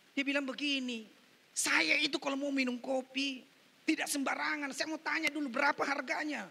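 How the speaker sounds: loud, high sermon storytelling; preaching. Acted. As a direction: retell it forcefully to the congregation. Preaches at full force.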